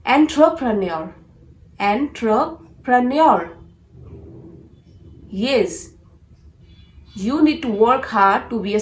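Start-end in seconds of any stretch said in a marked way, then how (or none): 0.0-3.5 "entrepreneur" as en-TRO-pre-nee-or; "entrepreneur" broken into en, tro, pre-nee-or; correct entrepreneur. Shown as a demonstration. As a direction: demonstrate "entrepreneur" with a clear TRO, then slowly by parts. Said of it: "pronounce this word as".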